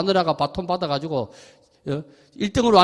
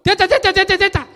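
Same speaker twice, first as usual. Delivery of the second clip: excited cheering; 12 semitones higher, 4 dB louder than usual. Retold or imitated. Excited retelling, high laughing quote.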